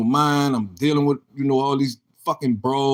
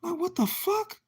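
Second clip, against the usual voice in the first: retold disbelief; 9 dB quieter than usual. He reacts to news of the visit.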